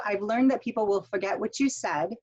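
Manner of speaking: "I've learned that people will forget what you said," read as one chunk, then stop; pause after the thought group. Shown as a demonstration. Teacher to students, reading the quote as one chunk with a pause at the end. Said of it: "thought group, stop".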